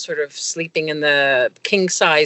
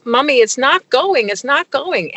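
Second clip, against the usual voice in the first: put-on scared child; 7 semitones higher than usual. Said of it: "she says".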